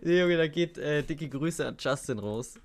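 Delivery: usual speaking voice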